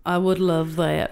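slow mock Alabama drawl; stretched-out Alabama twang; Alabama accent. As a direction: play along in a put-on Alabama accent. Co-host joins the Alabama accent game.

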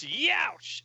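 high-pitched mock cry of pain; acted yelp. Villain poked in the keister, acted yelp.

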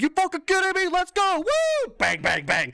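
high, loud whooping hype; over the top. Retold or imitated. Pitcher thinks the Cubs called him, whooping over the top. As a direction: shout it like he can't believe his luck. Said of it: "over the top".

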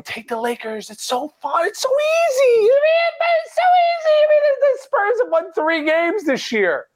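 tempting whisper rising into a manic screech; creepy inner voice. Retold or imitated. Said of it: "like a creepy voice in my head going".

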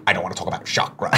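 low, quick mock Doctor Strange accent; imitated Doctor Strange accent. Host mimicking the film's accent his wife questioned, mock Doctor Strange accent. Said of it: "What the fuck is that accent".

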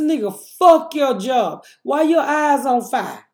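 loud incredulous rebuke; exasperated yell. Retold or imitated. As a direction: shout back at the son in disbelief. Mother snaps back at son.

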